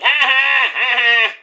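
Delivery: teasing, hammed-up laugh; mocking laugh. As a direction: fake gloating laughter at rivals. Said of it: "I bragged and I was like".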